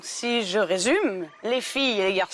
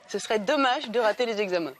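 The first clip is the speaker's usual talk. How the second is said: ordinary talk, nothing marked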